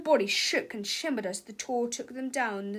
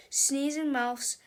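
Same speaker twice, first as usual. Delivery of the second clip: ordinary delivery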